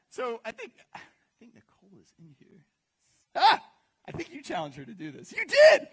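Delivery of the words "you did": loud high accusing shout; real shout. Real, not acted. Spotting Nicole in the crowd, loud laughing accusation. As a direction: call her out in the crowd, delighted.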